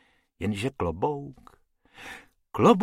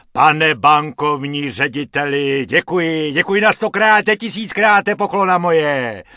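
loud, high, gushing thanks; cheerful calling out; 4 semitones higher, 9 dB louder than usual. Acted. Accordion player to the bank director, loud and jolly. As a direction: beam and call out your thanks. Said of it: "volá harmonikář a už se netváří dojemně, nýbrž rozjařeně".

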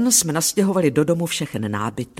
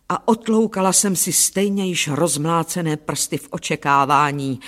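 plain reading voice

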